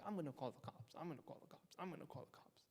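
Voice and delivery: imitating a fed-up mother; mock threatening mom. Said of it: "mom's like".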